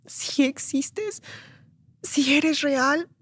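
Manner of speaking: rogarle a Dios en voz aguda y queda; muy lento, pausado, apagándose al final; súplica llorosa recreada. Retold or imitated. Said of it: "y yo lloré y le dije". (ruego lloroso a media voz)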